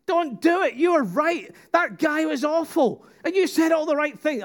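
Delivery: high-pitched, urgent pleading yell; re-enacted viewer's outcry, voice pitched right up; shouting at the television. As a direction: yell at the TV in exasperated protest. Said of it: "I'm still shouting at the television".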